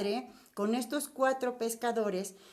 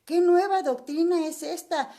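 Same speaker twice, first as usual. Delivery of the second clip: higher and louder amazed questions; astonished crowd; 5 semitones higher, 5 dB louder than usual. A narrator's reading. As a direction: gasp out the wonder of the crowd. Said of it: "discutían diciendo".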